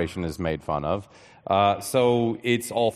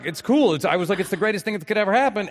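gleeful; 10 semitones higher, faster than usual. Retold or imitated. Gleeful reaction quoted.

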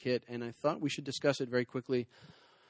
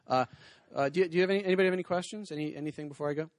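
ordinary talk, nothing marked

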